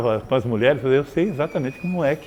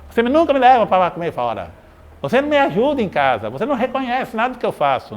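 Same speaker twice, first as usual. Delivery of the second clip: complaining spouse; 6 semitones higher than usual. Retold or imitated. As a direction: list the grievances like a fed-up wife. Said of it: "Tem casais que falam assim".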